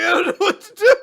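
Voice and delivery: loud, high comic wail; mock helplessness. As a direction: wail it as baffled onlookers. Acts out panicked onlookers.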